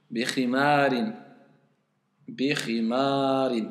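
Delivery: "Bikhimarin" slowly, syllable by syllable; slow model word. Shown as a demonstration. Teacher slowly models "Bikhimarin" for student to repeat. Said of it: "Ahsan, bikhimarin".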